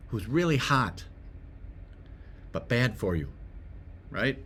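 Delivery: ordinary talk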